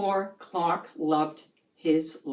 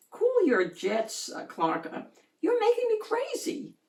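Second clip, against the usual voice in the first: exasperated friend; 13 semitones higher than usual. Acted. Fish friend voiced, exasperated.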